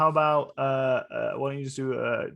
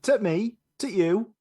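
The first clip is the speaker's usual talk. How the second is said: higher-pitched Yorkshire catchphrase; Yorkshire accent; 8 semitones higher, 3 dB louder, slower than usual. Shown as a demonstration. Trying out a Chuckle Brothers catchphrase, Yorkshire accent. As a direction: put on a cheeky Yorkshire accent. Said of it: "what should I say in a Yorkshire accent".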